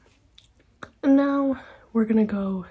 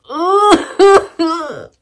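laughing cry; 10 semitones higher, 14 dB louder than usual. Real, not acted. Upset about a guy not texting.

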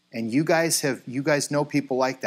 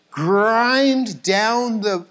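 much higher, louder, drawn-out words; dragged-out grinding; 6 semitones higher, 5 dB louder, much slower than usual. Shown as a demonstration. Pastor mimics a movie record scratch, dragged out. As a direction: stretch the words like a record needle scraping to a halt.

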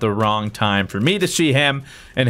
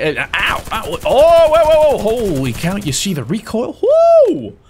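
excited; 10 semitones higher, 6 dB louder than usual. Retold or imitated. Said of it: "He's like".

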